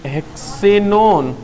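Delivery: drawn out syllable by syllable for students; slow spelled-out name. Shown as a demonstration. Teacher to class, naming the compound, slow and clear. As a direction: say हेक्सेनोन very slowly, each part clear. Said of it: "तो क्या लिखेंगे".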